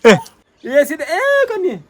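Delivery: put-on high, lisping baby talk to a little girl. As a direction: coo at the toddler in a high lisp. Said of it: "वो तोतले वाली style की ना".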